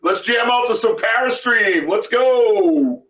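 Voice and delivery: loud, pumped-up call to jam out; rally the room to play music; excited hype. Real, not acted. Hyping music on the live show.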